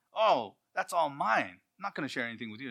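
hammed-up selfish kid; greedy sibling. Selfish sibling grabbing cake.

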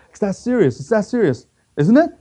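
high-pitched worried husband, acted out; mock alarm. Husband questions his sobbing wife about the diagnosis, mock alarmed.

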